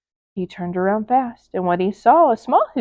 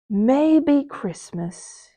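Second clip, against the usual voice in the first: very slow, softer wondering; thoughtful Grinch; 4 semitones higher, 4 dB quieter, much slower than usual. Acted. The Grinch realizing the truth, slow and soft. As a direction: let the thought dawn slowly. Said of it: "he thought".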